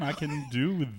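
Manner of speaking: ordinary delivery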